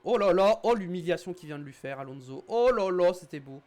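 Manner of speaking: excited race commentary, loud bursts; motorsport commentator. Acted. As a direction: call the overtake like a TV commentator. Celebrates a rival's overtake. (commentateur sportif enthousiaste)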